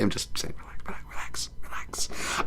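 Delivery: unvoiced whisper; hushed aside. Real, not acted. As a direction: whisper it under your breath to soothe. Aside after touchy joke, calming listeners.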